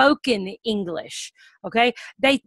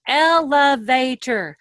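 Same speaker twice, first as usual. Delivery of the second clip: Elevator said slowly, syllable by syllable, louder; broken into syllables; 6 dB louder, much slower than usual. Shown as a demonstration. Teacher breaking up a word for a student, syllable by syllable. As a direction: tap out each part of Elevator. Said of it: "Show it with your fingers".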